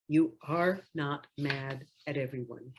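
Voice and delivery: usual speaking voice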